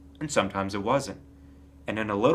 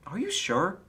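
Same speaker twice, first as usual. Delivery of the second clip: anxious; 8 semitones higher than usual. A narrator's reading. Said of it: "asked Piglet anxiously".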